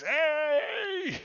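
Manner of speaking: high, loud shouted hey; angry shout. Acted. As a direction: shout at the one pushing him.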